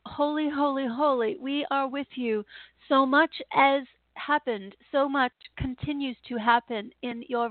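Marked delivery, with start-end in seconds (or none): none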